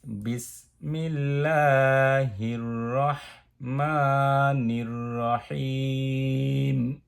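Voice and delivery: slow, drawn-out Quranic chanting; slow recitation. Shown as a demonstration. Teacher reciting the opening phrase slowly for students. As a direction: intone each syllable long and melodic. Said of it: "তাহলে আমি আর করে বলি".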